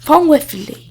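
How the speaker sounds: ordinary delivery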